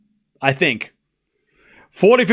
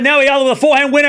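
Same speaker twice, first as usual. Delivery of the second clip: fast, loud, higher burst of joy; excited shout; 6 semitones higher, 4 dB louder, much faster than usual. Real, not acted. Streamer calling the match-winning shot, fast excited shout. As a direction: shout it as the winner lands. Match point won live.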